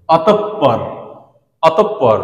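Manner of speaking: অতঃপর said "atop-par" with a held double p; geminated p in অতঃপর, no audible h; doubled consonant. Shown as a demonstration. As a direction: hold the p in অতঃপর before releasing it. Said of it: "পরের বর্ণটা দ্বিত্ব হবে".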